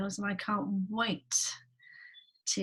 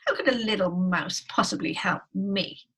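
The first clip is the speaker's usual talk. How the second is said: lightly voiced lion, louder and emphatic; scoffing lion; 6 dB louder than usual. Lion dismissing the mouse's offer, lightly voiced scoffing. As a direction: scoff with punched words. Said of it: "Lion laughed".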